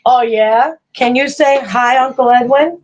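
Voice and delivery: grandma prompting grandson to greet; coaxing. Real, not acted. Coaxes toddler to greet viewers.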